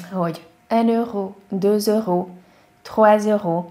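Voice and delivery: un euro, deux euros, trois euros with a rounded ö; French counting. Shown as a demonstration. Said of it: "Ezt talán már hallottátok".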